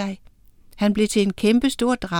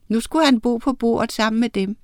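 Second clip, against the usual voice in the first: ordinary delivery, nothing marked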